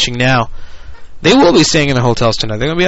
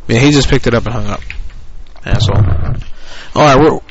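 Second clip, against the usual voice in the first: normal talking voice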